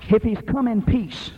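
ordinary delivery

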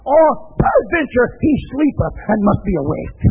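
high shouted scripture reading; preaching at full force. Acted. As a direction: thunder out the verse.

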